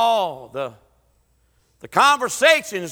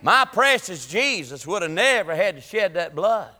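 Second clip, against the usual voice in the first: usual speaking voice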